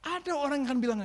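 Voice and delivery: normal talking voice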